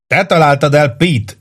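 high-pitched narrated yell; shouting. A narrator's reading. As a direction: yell it over the noise to a comrade. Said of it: "ordította Grenelli".

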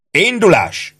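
high-pitched marching command; bellowed order. A narrator's reading. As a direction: bark it out to move the men. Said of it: "bömbölte Ike". (magasan elbődült vezényszó)

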